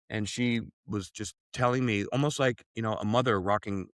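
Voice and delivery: usual speaking voice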